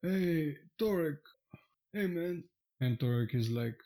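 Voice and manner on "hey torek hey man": high, laid-back dude voice; a stoner dude greeting his friend, man; Sasha's voice. Acted. Sasha greets his lookalike in a high dude voice. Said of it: "points out, uh, an elderly dwarf and says".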